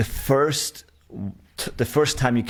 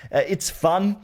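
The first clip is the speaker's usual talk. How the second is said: ordinary talk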